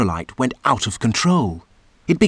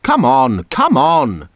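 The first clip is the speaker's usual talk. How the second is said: shouted crossly; 3 dB louder than usual. A narrator's reading. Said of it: "she shouted crossly".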